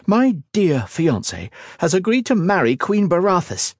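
high-pitched sarcastic indignation; angry snap. A narrator's reading. Daughter tells her father of the betrayal, snapping. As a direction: snap it out, bitter and outraged. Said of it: "she snapped".

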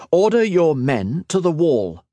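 high, forceful military order; commanding. A narrator's reading. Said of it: "Liniel commanded".

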